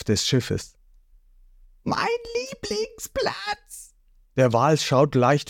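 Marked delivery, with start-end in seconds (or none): 1.8-3.9 very high, slow, soft cartoon wail; dismayed boy hero. Ruffy seeing his favourite spot broken, soft wail. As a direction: wail over the lost seat.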